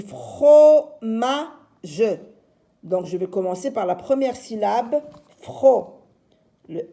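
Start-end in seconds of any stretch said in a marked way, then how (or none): 0.0-1.5 "fromage" louder, split fro, ma, ge; syllabes détachées. Shown as a demonstration. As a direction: separate the three syllables of "fromage" for children. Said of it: "Alors moi j'ai dit". (« fromage » découpé en trois syllabes)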